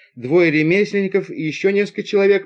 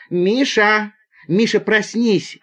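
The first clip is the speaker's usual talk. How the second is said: higher, insistent calling of a name; urgent waking call; 6 semitones higher, slower than usual. Acted. Passenger waking Misha over the viper, urgent. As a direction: call out high to rouse a sleeper.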